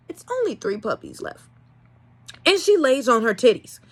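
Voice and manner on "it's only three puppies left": ordinary talk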